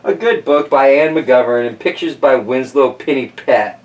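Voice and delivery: ordinary delivery, nothing marked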